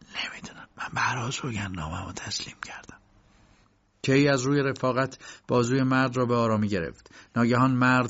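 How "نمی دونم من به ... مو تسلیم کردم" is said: soft, half-whispered reply; hushed, barely voiced answer; thin small voice. A narrator's reading. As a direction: say it small and under the breath. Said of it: "با صدای زیری گفت".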